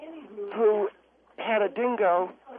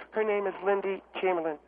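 ordinary talk

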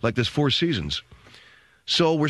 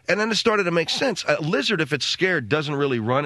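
usual speaking voice

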